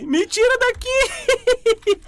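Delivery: very high, slow panicked wail; being chased. Real, not acted. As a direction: stretch out the plea in mock terror. Monster chasing player.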